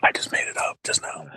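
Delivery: sly unvoiced whisper. Real, not acted. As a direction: whisper the confession. Confessing the made-up rumour.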